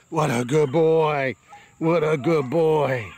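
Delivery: slow, drawn-out praise to the puppy; lavish slow praise on the puppy; praising the pup. Real, not acted. Praising puppy in slow baby talk.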